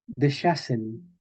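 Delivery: deshasen slowly as de-SHA-sen; medieval pronunciation. Shown as a demonstration. Teacher reading an example, medieval sh pronunciation. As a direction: stretch deshasen with a clear sh. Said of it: "el sonido sh".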